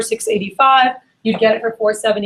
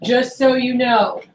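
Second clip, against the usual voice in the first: "Just so you know" slowly stretched out; slow, emphatic "Just so you know"; key phrase; slower than usual. Shown as a demonstration. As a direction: savour each word of "Just so you know". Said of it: "This will make you a lot of money".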